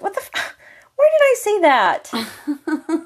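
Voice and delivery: high, slow, disbelieving; stunned at own words; shocked disbelief. Retold or imitated. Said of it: "and I'm like".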